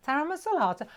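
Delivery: high imitated comeback; lilting mock echo, falling at the end; sons' retold reply. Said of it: "both of them".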